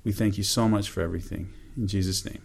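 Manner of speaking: soft, humble closing prayer; hushed heartfelt prayer to close. Acted. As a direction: pray quietly to the Lord.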